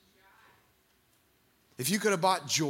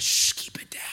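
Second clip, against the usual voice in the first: hushing whisper; 4 dB louder than usual. Retold or imitated. Crowd hushing the blind man, whispered shush. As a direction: hiss it down like a scolding crowd. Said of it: "they said".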